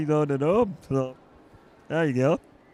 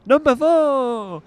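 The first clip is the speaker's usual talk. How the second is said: excited call; 6 semitones higher, 7 dB louder, slower than usual. Acted.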